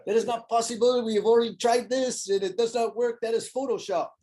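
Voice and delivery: put-on high-pitched, haughty French accent throughout; mock French accent. Mocking a foiling buddy who calls it fake, in a French accent. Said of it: "trying to give him a French accent".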